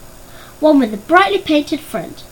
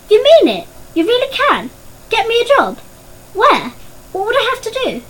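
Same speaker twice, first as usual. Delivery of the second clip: put-on higher, hopeful boy's voice bursting with disbelief; a poor boy can't believe his luck; eager boy; 10 semitones higher, 4 dB louder than usual. Boy to his uncle about a job, eagerly excited.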